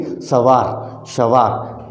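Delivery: सवार drawn out slowly with oral vowels; slow careful demo. Shown as a demonstration. Teacher slowly showing students how सवार is said. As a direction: spell out सवार slowly, no nasal air. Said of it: "तो हम कैसे करेंगे?".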